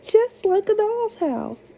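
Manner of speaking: high, bright princess exclamation. A narrator's reading. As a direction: gush like a delighted young princess.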